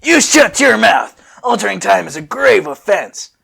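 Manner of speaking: loud slowed stern command; Trunks voice again. Acted. Trunks warning the kids, a stern shouted command. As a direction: bark it out like an angry soldier. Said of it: "All right".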